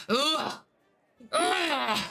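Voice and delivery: heaving grunt of effort; straining grunts. Acted. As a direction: grunt like lifting something heavy. Dragonborn strains lifting the bar.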